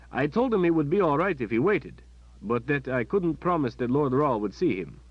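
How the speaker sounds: the kindly palace captain; captain's voice. Acted. Captain telling Kalen about the waiting boy, friendly.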